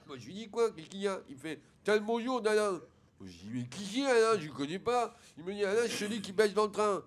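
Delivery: lisped, worked-up retelling; speech defect. Acted. Son quoting the classmate to his father, lisped. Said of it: "il parle comme ça".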